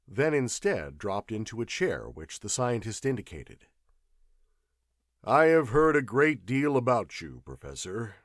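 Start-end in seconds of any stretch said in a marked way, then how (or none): 5.2-8.2 louder, polite gentleman's opening; well-modulated voice. Acted. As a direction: address the famous professor courteously. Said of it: "he began in a well-modulated voice".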